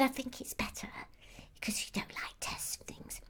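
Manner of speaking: hushed, secretive whisper of the advice. Retold or imitated. Elf retelling the boss's advice, whispering it. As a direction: pass on his words as a soft whisper.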